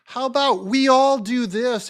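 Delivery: sing-song scolding teacher; Sunday school teacher. Retold or imitated. Host mocking liberals as a prim Sunday school teacher. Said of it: "the teacher, Sunday school teacher".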